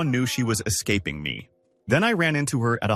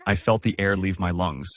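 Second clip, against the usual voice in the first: usual speaking voice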